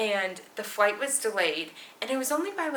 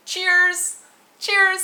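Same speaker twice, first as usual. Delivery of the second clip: high, loud, bright "Cheers!"; cheery toast; 12 semitones higher, 7 dB louder than usual. Acted. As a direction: raise a glass and shout "Cheers!".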